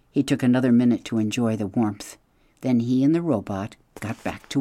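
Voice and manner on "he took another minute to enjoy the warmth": ordinary delivery, nothing marked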